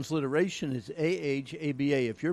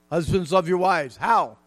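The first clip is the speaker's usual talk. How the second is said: loud, ringing call to husbands; emphatic preaching; 3 semitones higher, 10 dB louder than usual. Real, not acted. Preacher calling loudly on husbands to love their wives. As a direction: preach it out to the whole room. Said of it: "preaching at full force".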